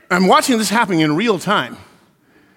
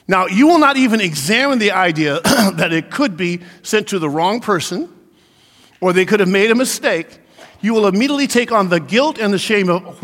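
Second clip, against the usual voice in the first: ordinary talk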